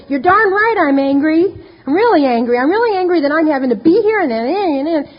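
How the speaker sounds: finally admitting how angry she is; angry outburst. Retold or imitated. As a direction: let the anger burst out. Retold angry outburst in therapy.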